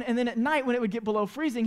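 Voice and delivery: normal talking voice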